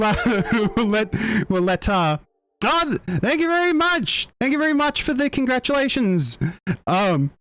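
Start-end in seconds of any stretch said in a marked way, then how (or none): 2.6-6.4 high-pitched, gushing gratitude; excited thanks. Real, not acted. Streamer thanking viewers for congratulations, excited. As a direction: thank them in a high, thrilled voice.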